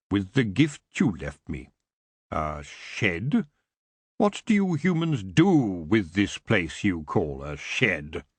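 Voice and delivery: low, slow, curious; intrigued elf king. Acted. Elf king asking Tiffany about the shed, intrigued. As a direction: puzzle over the word shed, low and slow. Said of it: "He sounded intrigued".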